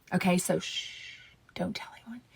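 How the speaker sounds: hushed gossip fading into a whisper; secretive whisper. Real, not acted. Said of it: "So I'm gonna whisper it".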